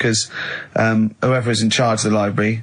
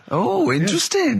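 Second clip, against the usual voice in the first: laughing; 11 semitones higher, slower than usual. Real, not acted. Mock interest, laughing.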